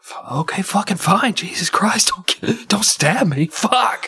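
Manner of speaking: high, half-whispered surrender; nervous parent caving in; hushed scared give-in. Acted. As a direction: whisper it so the kid won't stab you.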